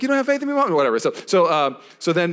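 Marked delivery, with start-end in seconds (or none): 0.0-0.7 whiny son. Retold or imitated. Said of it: "he's like".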